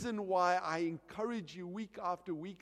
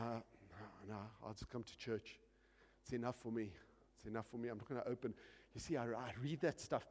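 low, soft, unenthused reply; reluctant churchgoer; 8 semitones lower, 9 dB quieter, faster than usual. Acted. Church member declining to read the Bible, low dull excuses. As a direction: brush it off quietly and low.

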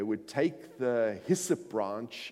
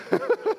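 high laugh; 5 dB louder than usual. Real, not acted. Laughing at his own story.

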